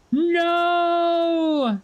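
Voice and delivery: sobbing shout of no; anguished cry. Acted. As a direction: cry out in despair. Wife cries out in grief.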